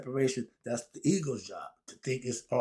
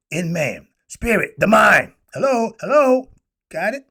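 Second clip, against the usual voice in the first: emphatic exclaim; 6 semitones higher, 13 dB louder than usual. Real, not acted. Emphatic teaching point.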